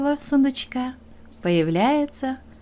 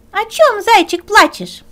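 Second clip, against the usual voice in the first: higher, louder rooster voice; rooster asking; 10 semitones higher, 9 dB louder than usual. Acted. Rooster asks the hare why he cries, higher and louder. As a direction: ask brightly like a rooster.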